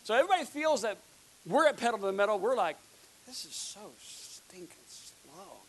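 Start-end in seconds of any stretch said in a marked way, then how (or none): 3.3-5.6 slow, hushed mock complaint; whispered grumble. Staff chafing at turtle pace, whispered complaint. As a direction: grumble it slowly under your breath.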